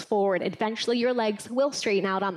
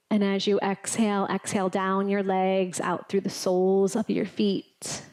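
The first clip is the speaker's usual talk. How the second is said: guided relaxation; 3 semitones lower than usual. Acted. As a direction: ease them down gently. Leads final relaxation.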